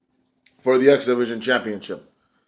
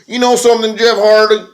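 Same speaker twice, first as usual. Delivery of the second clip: much higher, louder put-on promo voice; hammed-up champion gloating at his brother; imitating Matt Hardy; 8 semitones higher, 6 dB louder than usual. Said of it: "Matt Hardy goes".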